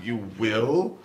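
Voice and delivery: slow, disbelieving question after hysteria; skeptical, rising at the end; mock incredulity. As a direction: draw it out, doubting every word. Deadpan reply to melodrama.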